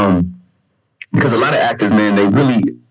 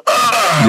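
waking groan; 11 semitones higher than usual. Retold or imitated. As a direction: stretch out a big waking-up yell. Waking up in trailer.

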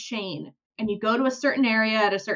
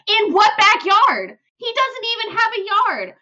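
much higher, louder, outraged disbelief; incredulous scoff; 11 semitones higher, 6 dB louder than usual. Retold or imitated. The only farmer scoffing at Pierre's turnip claim, outraged disbelief.

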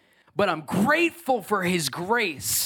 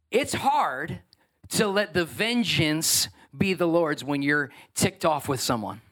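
ordinary delivery

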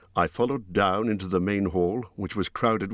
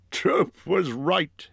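higher, slow chuckling tone; 10 semitones higher, much slower than usual. A narrator's reading. Bjorn voiced by narrator.

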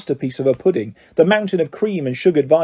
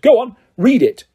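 higher, menacing command; evil hiss; 6 semitones higher, 4 dB louder than usual. A narrator's reading. Uncle brandishes the letter at Harry, menacing hiss. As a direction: goad him with menace, pitched up. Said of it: "he hissed evilly".

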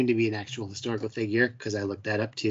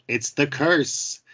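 mock spooky murderer; 4 semitones higher, much slower than usual. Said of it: "if you're a murderer to be like".

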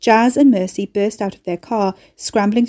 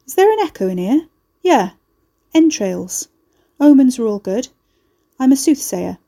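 cocky mocking rogue; 4 semitones higher, 5 dB louder than usual. A narrator's reading. Ricky mocks her echo.

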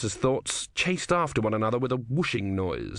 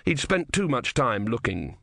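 ordinary delivery, nothing marked

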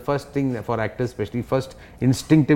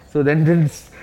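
ordinary talk, nothing marked

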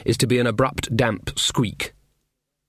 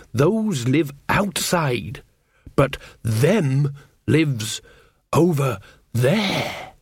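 very slow, weighted words with pauses; ominous hinting; much slower than usual. Acted. Mother hinting to her son about them, ominous. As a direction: spell it out slowly and darkly.

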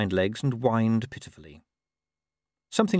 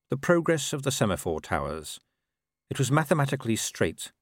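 ordinary delivery, nothing marked

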